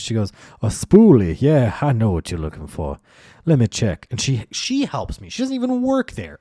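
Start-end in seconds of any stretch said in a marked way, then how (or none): 0.6-4.1 louder, bright-eyed helper's reply; imitated helpful stranger, loud and sure; eager recognition. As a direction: jump in as the helpful shopper. First helpful woman voiced, recognizing item.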